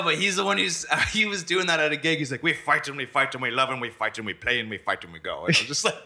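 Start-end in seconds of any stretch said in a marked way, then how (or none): 2.4-5.4 put-on Irish accent. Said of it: "Willie does that really good".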